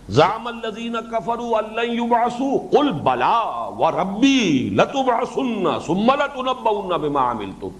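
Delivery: measured Arabic recitation with long held vowels; sustained, slow-cadenced scripture recitation; Quran recitation. Acted. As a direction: recite the verse, stretching syllables and falling at the close.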